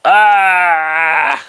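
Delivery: loud, high anguished "Ah!"; real emotional outburst; frustrated yell. Real, not acted. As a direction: let out an overwhelmed cry. Overwhelmed by sad movie scene.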